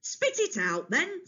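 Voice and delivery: high, brusque demand from a king; impatient Kronos. A narrator's reading. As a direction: tell him to get on with it.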